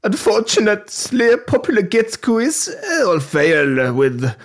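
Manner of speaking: hammed-up German accent, condescending; exaggerated, mocking German accent with drawn-out words. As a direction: read it in a silly German accent, pitch climbing. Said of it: "read this in the German accent".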